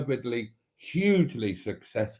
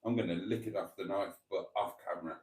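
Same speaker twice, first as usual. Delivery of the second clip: much softer, quick confiding aside; quiet aside; 4 semitones lower, 11 dB quieter, faster than usual. Real, not acted. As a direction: drop to a cheeky murmur. Conspiratorial aside about licking knife.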